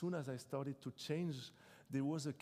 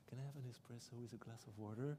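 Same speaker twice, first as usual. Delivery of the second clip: softer, darker imitation of the philosopher ordering; very low voice; 7 dB quieter, faster than usual. Philosopher ordering espresso from the waiter, in a lightly imitated low voice. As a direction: mimic his low, quiet voice, lightly done. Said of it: "he said it with his very low voice".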